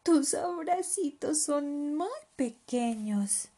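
high, slow cub voice; gentle little child voice, drawn out; smiling little leopard. Acted. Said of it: "sonrió Leopardito".

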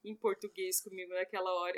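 ordinary delivery

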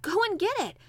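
high, loud, urgent order; boy bursting with excitement; excited. A narrator's reading. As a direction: send him off eagerly. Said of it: "digging excitedly".

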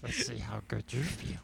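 quiet, croaky Yoda voice; Yoda impression. Quoting Yoda to friends in a Yoda voice. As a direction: speak it like little old Yoda. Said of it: "Where did Yoda even come from".

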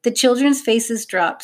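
ordinary delivery

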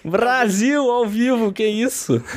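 high-pitched, hammed-up disbelief; mock shock. Podcast host teasing co-host over a risky joke, mock shock.

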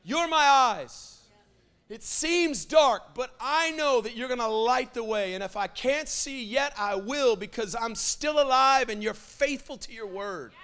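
high, loud cry then earnest slow prayer; fervent stirred-up prayer. Shown as a demonstration. Preacher showing how to stir your heart up, high and earnest. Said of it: "we need to sit on it and go".

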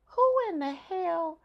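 high, slow disbelief; stunned at the child's nerve; incredulous thought. Retold or imitated. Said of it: "wondering to yourself".

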